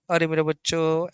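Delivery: ordinary delivery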